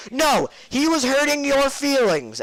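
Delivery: high, slow mock screech; insistent scolding teacher. Art teacher overruling the teased friend, mock insistent screech. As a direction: overdo the teacher's shrill insistence.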